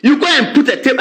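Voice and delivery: ordinary talk, nothing marked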